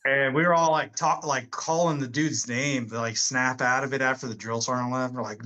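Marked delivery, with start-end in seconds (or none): none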